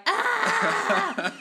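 high, loud mock scream breaking into a laugh; hysterical scream. Shown as a demonstration. Dubber showing her random screaming character, high mock scream. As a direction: let out a hammed-up hysterical shriek. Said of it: "be like / hysterical screaming person".

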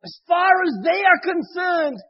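fervent, loud sermon delivery; preaching. Acted. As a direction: preach it out with full force. Preaching to the audience.

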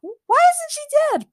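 very high, loud panicked yelling; panicked scream. A narrator's reading. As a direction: shriek it in terror. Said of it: "Morgana screamed".

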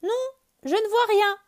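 very high, loud call down; bird answering. Acted. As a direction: answer from far up in the sky. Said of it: "répond Oiseau".

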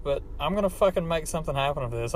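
ordinary delivery

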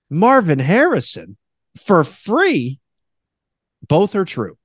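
normal talking voice

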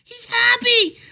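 very high, cooing mock-lady voice with a laugh; mocking sweet lady. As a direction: squeal it like a patronizing stranger. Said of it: "she responds".

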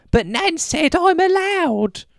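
put-on very high, drawn-out toddler whine; screaming toddler. Toddler arguing back at the parent, screaming toddler voice. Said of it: "against the toddler screaming loud".

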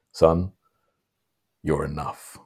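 soft and low, barely there; tender. Retold or imitated. Man at the end tells the kid he's enough, softly. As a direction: hush it like a loving father. Father figure's gentle line.

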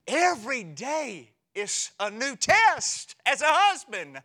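high, loud and slow; emphatic punchline. Real, not acted. Preacher landing a joke to the congregation, loud and slow. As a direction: punch it out for laughs.

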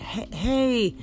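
snatching back his phone, hey hey; protesting. Acted. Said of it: "Mason protested".

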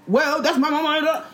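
high, drawn-out mock comeback; mocking retort. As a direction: imitate a silly, defensive reply. Mocking others' retort in a retelling.

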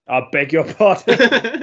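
loud, slow rising disbelief; scandalised double-take; mock shock. Mock shock at friend's remark.

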